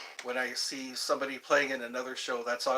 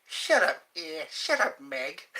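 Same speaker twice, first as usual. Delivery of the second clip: mock Peter Griffin; slower than usual. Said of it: "all I could think".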